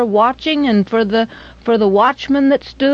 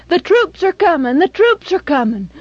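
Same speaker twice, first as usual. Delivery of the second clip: raised, higher cry of alarm; alarmed shout; 8 semitones higher, 3 dB louder than usual. Acted. Townsfolk crying out that troops are coming, loud alarm. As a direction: call out the warning to the town.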